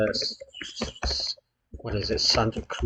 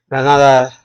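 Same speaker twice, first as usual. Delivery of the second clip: Spanish accent attempt; 3 semitones higher, 10 dB louder, faster than usual. Real, not acted. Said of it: "Sorry for the accent".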